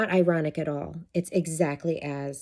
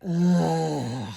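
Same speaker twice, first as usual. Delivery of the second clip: louder groan of irritation; annoyed groan; 4 dB louder than usual. Retold or imitated. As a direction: let out a fed-up ugh. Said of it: "what I made it mean in that moment was like".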